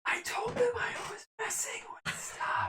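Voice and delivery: breathy unvoiced whisper over the line; whispering on the phone. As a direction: secret whisper through a mental link. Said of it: "with that whisper's really".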